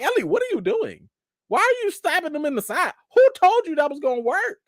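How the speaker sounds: high-pitched exasperated questioning; mock scolding. Mock scolding Ellie over stabbing.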